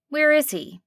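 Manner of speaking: a friendly diner waitress with a slight twang; a hint of a nasal twang. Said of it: "slight twang to it".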